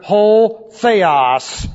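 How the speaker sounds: "Ho Theos" said slowly, each syllable set apart; Greek term. Shown as a demonstration. Teacher naming the Father in Greek, slow and deliberate. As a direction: spell out "Ho Theos" carefully, like a Greek lesson. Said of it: "who is called here".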